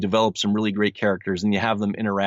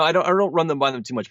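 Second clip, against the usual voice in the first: ordinary delivery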